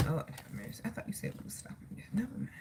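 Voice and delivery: barely audible, mostly whispered aside; hushed private grumble; under the breath. Real, not acted. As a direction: mutter it to God, almost no voice. Talking to God under breath.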